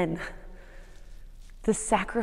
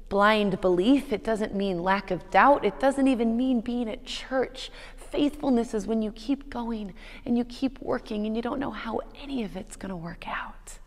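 ordinary delivery

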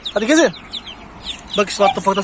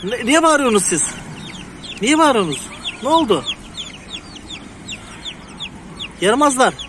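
playful scolding; 5 semitones higher than usual. Real, not acted. Farmer to chirping chicks, higher fond mock scolding. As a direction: tell the noisy chicks off fondly.

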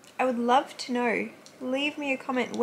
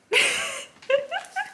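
high delighted giggle. Real, not acted. Delighted squeal at dog.

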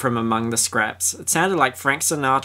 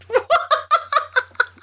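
high burst of laughter. Real, not acted. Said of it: "not worth the time".

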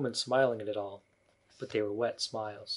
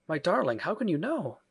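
higher-pitched, startled question; a touch of wonder, voice lifting; mildly startled; 7 semitones higher than usual. A narrator's reading. Startled Wendy questions her daughter, higher and mildly surprised.

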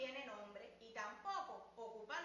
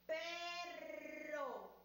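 slowly; 4 semitones higher than usual. Shown as a demonstration.

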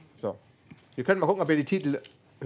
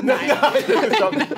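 laughing; 10 semitones higher, 7 dB louder than usual. Real, not acted. Nein after joke, laughing.

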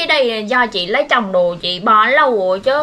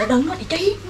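hushed warning; 7 dB quieter, faster than usual. Real, not acted. Stops friend from giving out the address, hushed. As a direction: warn them off without the caller hearing. Secret warning during the call.

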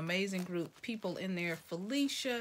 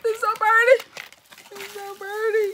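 gasp, then much higher and louder squeals of delight; excited gasp; 12 semitones higher, 14 dB louder than usual. Real, not acted. Vlogger opening a friend's gift box, squealing with delight. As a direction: squeal it like you just opened the perfect gift.

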